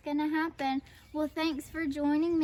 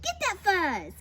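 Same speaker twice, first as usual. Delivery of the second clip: raised, urgent command; urgent order; 7 semitones higher, 3 dB louder than usual. A narrator's reading. Prairie dog orders others after fuzz, urgent. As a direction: egg the fighters on, not yet yelling. First of two battle cries.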